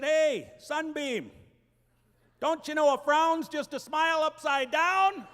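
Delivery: loud, slow cheesy sweet talk; hammed-up pet-name charm, stretched out. Husband coaxing his wife in labor, loud and syrupy. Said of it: "and I said".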